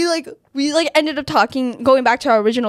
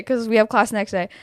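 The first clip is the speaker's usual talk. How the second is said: ordinary delivery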